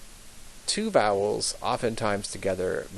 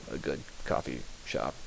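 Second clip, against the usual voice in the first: unvoiced whisper joke; whispered aside; 9 semitones lower, 10 dB quieter, faster than usual. Real, not acted. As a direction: slip it in under your breath. Joke aside about brand.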